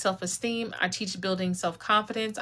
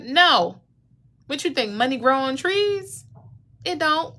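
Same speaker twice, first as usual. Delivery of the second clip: a sharp, higher mom putting her foot down; scolding mom; 6 semitones higher, 4 dB louder than usual. Retold or imitated. Mom refusing her child money, loud and higher. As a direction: snap no at the begging child. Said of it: "Your mom said".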